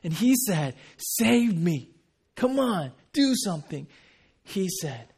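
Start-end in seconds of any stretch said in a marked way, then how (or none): 1.0-3.9 impatient, raised-pitch demand; mocking criminal's impatient orders. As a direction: push him to act right now. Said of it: "the attitude is completely different".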